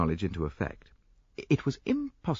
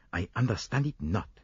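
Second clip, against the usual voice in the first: usual speaking voice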